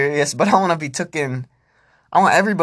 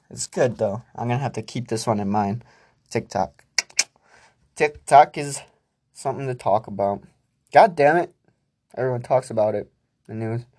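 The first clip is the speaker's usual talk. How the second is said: ordinary talk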